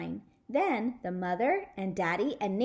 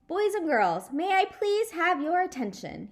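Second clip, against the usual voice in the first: put-on raised, louder call for the kids' attention; a host calling a party to order; announcing dad; 9 semitones higher, 5 dB louder than usual. The daddy to the children, a loud host call.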